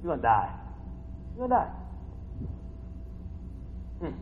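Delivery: ordinary talk, nothing marked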